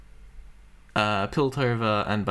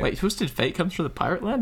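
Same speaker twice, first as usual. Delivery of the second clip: surprised; 9 semitones higher than usual. Real, not acted. Surprised question.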